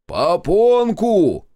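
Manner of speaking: high, loud, slowly stretched holler; deafened shout. A narrator's reading. Deafened Shestakov repeating misheard word to Zotov, loud stretched shout. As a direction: shout back what you misheard.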